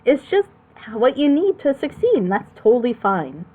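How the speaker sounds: ordinary talk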